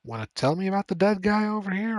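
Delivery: quick, high, offhand question; casual questioning. Retold or imitated. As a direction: ask it breezily like nothing's wrong. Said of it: "I would probably be like".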